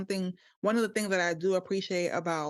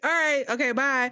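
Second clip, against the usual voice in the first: dad voice; 6 semitones higher, 5 dB louder than usual. Retold or imitated. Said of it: "my dad will be quick to be like".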